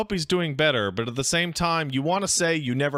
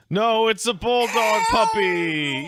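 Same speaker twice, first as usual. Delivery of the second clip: loud, slow excited shout; 5 semitones higher, 4 dB louder, slower than usual. Real, not acted. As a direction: burst out excited. Excited about puppy video.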